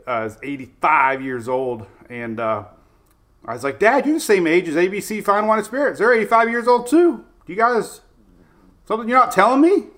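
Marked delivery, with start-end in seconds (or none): 3.8-9.8 mock amazed. Joking to dad about sponsor age.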